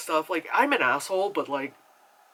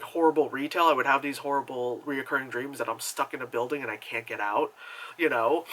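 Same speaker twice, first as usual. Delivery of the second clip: ordinary talk, nothing marked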